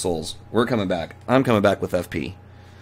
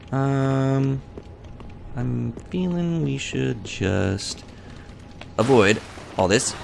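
ordinary talk, nothing marked

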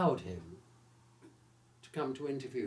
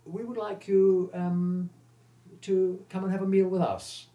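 higher, louder, genteel actress voice; Vivien Leigh's gracious invitation; 6 semitones higher, 6 dB louder than usual. Retold or imitated. Retelling Vivien Leigh inviting him to lunch, genteel. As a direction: voice her as a gracious star. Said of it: "And she said".

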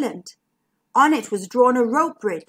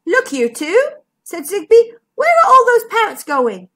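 excited; 9 semitones higher, 7 dB louder than usual. Acted. Zigbee noticing parrots.

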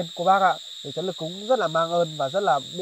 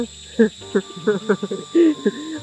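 long chuckle at the answer; real amused laughter; 5 semitones higher, 4 dB louder than usual. Real, not acted.

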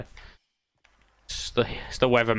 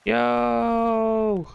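long, high drawn-out yo; amazed shout; 10 semitones higher than usual. Real, not acted. Gamer reacting to a surprise in play, amazed. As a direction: stretch it out high in disbelief.

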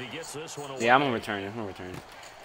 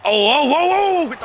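loud, high, stretched whoops; rising game-time hollering; excited outcry; 13 semitones higher, 9 dB louder than usual. Real, not acted. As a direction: cry out as the play unfolds. Reacting to play unfolding.

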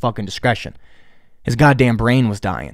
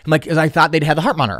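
ordinary delivery

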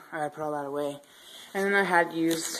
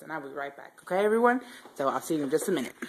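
normal talking voice

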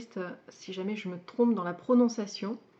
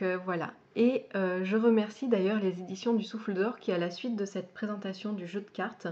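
usual speaking voice

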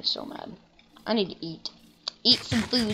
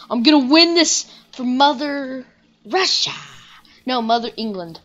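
mock battle cry; 5 semitones higher, 8 dB louder than usual.

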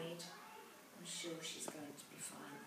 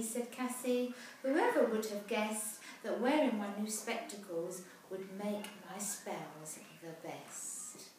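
put-on lively rhyming read-aloud for children; storybook narration; 7 dB louder than usual. A narrator's reading. Parent reading a rhyming picture book aloud, lively. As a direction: tell the rhyme brightly to a child.

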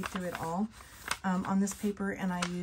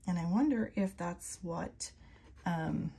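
normal talking voice

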